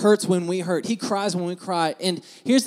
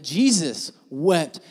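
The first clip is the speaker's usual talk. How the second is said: WEPT drawn out slowly and forcefully; slow, weighted stress on "wept"; emphatic WEPT; much slower than usual. Shown as a demonstration. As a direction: lean hard and long on "wept". Preacher contrasts weeping with ordinary crying.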